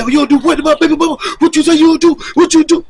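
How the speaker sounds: high, loud, furious retold yelling; angry threat. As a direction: bark the threats at him like the gunman did. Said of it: "Like that".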